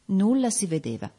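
ordinary delivery

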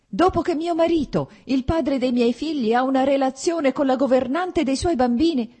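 high, loud, bitter outrage; wife accusing her husband of the affair, indignant and loud; indignant accusation. A narrator's reading.

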